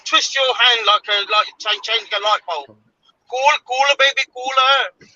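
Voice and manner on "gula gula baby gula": Indian-accent catchphrase, stretched out. Shown as a demonstration. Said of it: "in an Indian accent".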